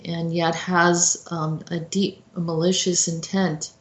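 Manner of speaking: ordinary delivery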